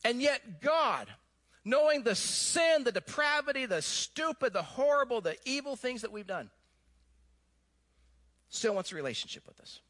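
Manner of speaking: normal talking voice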